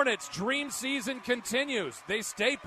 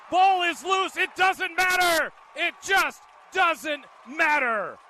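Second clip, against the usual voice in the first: high, loud shouting play-by-play; excited game call; 6 semitones higher, 7 dB louder than usual. Real, not acted. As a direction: roar the final stop live. Live call of game-ending fumble.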